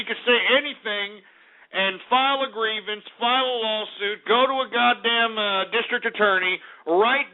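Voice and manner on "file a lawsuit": ordinary delivery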